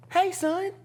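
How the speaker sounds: high, lilting motherly greeting; high-pitched impression of a mother; mom's voice. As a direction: imitate a mom calling her son. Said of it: "My mom goes".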